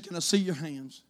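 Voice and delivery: a frail woman asking quietly; sick lady. Retold or imitated. Lightly voiced sick lady asking the priest, soft.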